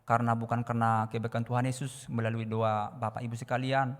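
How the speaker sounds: ordinary talk, nothing marked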